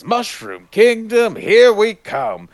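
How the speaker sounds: high, drawn-out, loud heroic cry; Mario movie line. Retold or imitated. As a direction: stretch it out high like a rallying call. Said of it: "in his second line where he goes".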